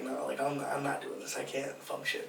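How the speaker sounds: low, very soft backing out; retold reluctant refusal. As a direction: imitate quietly bowing out of trouble. Retold refusal to get involved.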